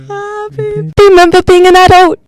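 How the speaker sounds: high, creepy sing-song birthday tune; Salad Fingers voice. Pretend. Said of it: "It is salad fingers".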